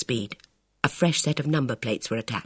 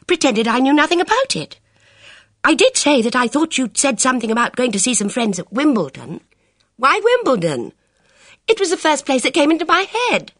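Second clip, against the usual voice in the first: high, anxious rush of words; fretful girl's voice; agitated; 11 semitones higher, 6 dB louder than usual. Acted. Bridget voiced.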